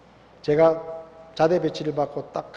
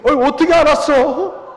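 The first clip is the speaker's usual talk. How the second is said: higher, louder smug comeback; mock gloating; 9 semitones higher, 8 dB louder than usual. Answering his enemy's accusation, a higher, louder smug taunt. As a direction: toss back a cheeky admission.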